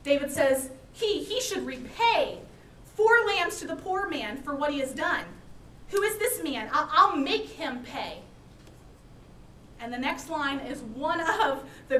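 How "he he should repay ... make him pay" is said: high, loud fired-up demand; furious and fired up. Acted. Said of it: "David is furious and fired up".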